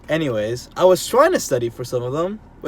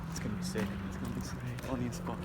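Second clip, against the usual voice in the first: hushed; 4 semitones lower, 17 dB quieter, faster than usual. Real, not acted. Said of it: "We gotta be quiet".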